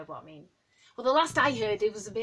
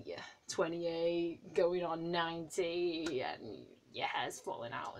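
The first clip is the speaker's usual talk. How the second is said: slow self-mockery; 3 semitones lower, 7 dB quieter, slower than usual. Real, not acted. Moaning slowly about going on ninety. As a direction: drag it out, slow and low. Self-mocking old age.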